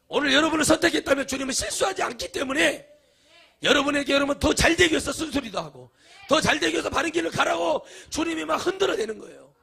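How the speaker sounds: high, loud shouted sermon; full-force preaching. Acted. Preacher exhorting the congregation, shouting and high. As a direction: preach it at the top of your voice.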